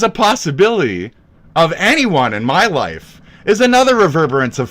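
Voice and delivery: high, loud sarcastic outburst; angry ranting. Real, not acted. Streamer lashing out at rival Hassan, angry ranting. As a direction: rant loudly, voice pushed up high.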